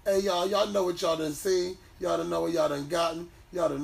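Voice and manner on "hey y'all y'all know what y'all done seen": normal talking voice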